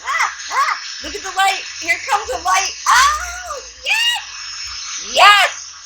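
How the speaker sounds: high, rising delighted cries; real delight building to a shout; thrilled gushing. Real, not acted. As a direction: gush as the image appears. Heat gun reveals the art.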